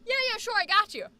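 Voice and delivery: quick, high, eager yes; eager agreement. Retold or imitated. Actor to client at a gig, eager and quick. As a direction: agree fast and upbeat.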